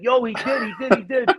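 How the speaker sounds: high, excited backup voice; eager recruiter. Retold or imitated. Recruiter backing up the story, high and excited. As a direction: chime in fast to confirm. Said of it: "He's like".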